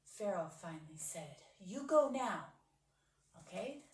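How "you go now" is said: Pharaoh's command. Acted. Pharaoh finally gives in.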